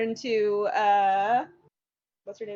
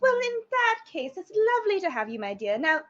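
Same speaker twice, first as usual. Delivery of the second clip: high, fluttering Lady Catherine voice, rushing; a high-pitched noble lady shooing a guest to the refreshments; grand hostess; 7 semitones higher than usual. Acted.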